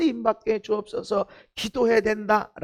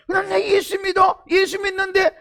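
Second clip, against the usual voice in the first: defensive shout; 9 semitones higher, 4 dB louder than usual. Retold or imitated. Preacher voicing a defensive believer.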